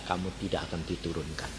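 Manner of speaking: low, very soft divine voice; God. Retold or imitated. God assures the struggling pupil, hushed and low. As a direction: speak gently low, almost under the breath. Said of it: "Tuhan bilang".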